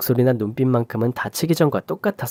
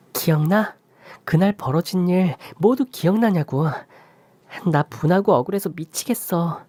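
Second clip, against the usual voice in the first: higher, pleading daughter voice, aggrieved; upset daughter; 6 semitones higher than usual. Acted. Daughter venting her outrage to her father, high and aggrieved. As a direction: pour out anger and hurt to him.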